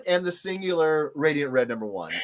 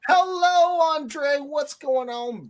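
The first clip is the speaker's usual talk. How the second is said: cheery greeting; 12 semitones higher, 3 dB louder, much slower than usual. Real, not acted. Greeting a chat viewer.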